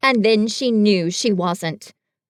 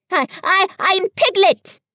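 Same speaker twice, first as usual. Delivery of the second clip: louder, higher, stumbling start; loud stammer; 9 semitones higher, 5 dB louder than usual. A narrator's reading. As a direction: stammer then insist. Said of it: "said Piglet loudly".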